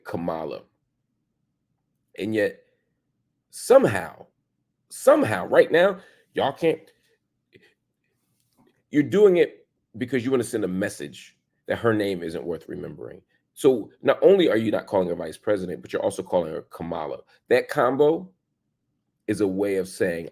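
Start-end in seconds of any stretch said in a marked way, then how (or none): 0.1-0.6 Kamala with the middle stressed, kuh-MAH-la; imitated mispronunciation of Kamala. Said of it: "intentional in your mispronunciation". 16.7-17.2 Kamala said as kuh-MAH-la; imitated mispronunciation of Kamala. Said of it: "intentional in your mispronunciation".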